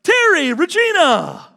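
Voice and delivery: loud, high, slow happy calling of two names; joyful welcome. Shown as a demonstration. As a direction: hail them across the room with delight. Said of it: "This is how he welcomes you".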